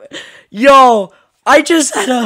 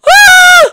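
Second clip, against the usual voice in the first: startled scream; 9 dB louder than usual. Real, not acted. Startled by jump scare.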